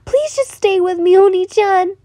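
put-on high, loud childlike begging through tears; tearful pleading. Crying boy begs his big sister to stay, tearful high plea. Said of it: "tears rolling down his cheeks".

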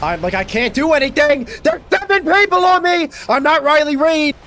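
high, loud outraged protest; frustrated yelling. Real, not acted. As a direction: build to a screaming complaint at the game. Overwhelmed by enemies, protesting.

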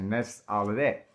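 normal talking voice

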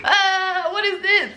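high-pitched mock dread; uneasy reaction. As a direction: act out an uneasy, high "what on earth" reaction. Retelling her dread at the flyer.

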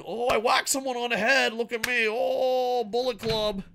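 high, mock-boastful show-off voice; mocking a bragging heel. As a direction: lightly ham up a cocky wrestler.

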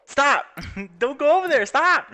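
high, loud shouts of stop; real frantic yell. Real, not acted. Gamer yelling at her sim to stop, frantic.

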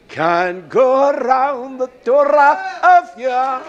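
loud, slow, high preacher cry; swelling sermon shout; full-force preaching. Real, not acted. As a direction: belt it out to the congregation, stretching each word.

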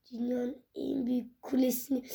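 normal talking voice

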